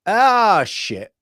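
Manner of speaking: resigned dismay. Acted. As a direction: act out giving up at the last second. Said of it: "there is no point even trying".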